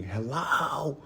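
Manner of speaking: high strained call of hello; mock scream. Calling after a woman who won't turn, mock yell. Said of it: "I was screaming".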